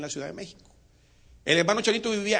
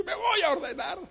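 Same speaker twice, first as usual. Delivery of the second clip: high promise; 13 semitones higher than usual. Acted. Chanito's promise.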